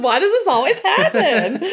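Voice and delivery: high, wailing mock complaint; hammed-up high-pitched lament; mock exasperation. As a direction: laughingly imitate someone at their wits' end. Voicing the exasperated family.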